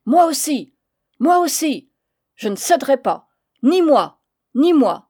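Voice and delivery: stubborn men shouting; shouting match. Acted. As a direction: yell over each other. Shouting match, several voices.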